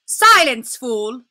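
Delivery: sharp loud command; furious shout. Acted. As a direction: snap it at him, icy and loud. Witch snaps at Edmund.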